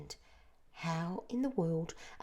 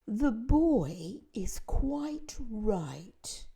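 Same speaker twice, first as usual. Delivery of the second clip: put-on old grasshopper, soothing; 5 semitones higher, 5 dB louder, much slower than usual. A narrator's reading. Old grasshopper calms others.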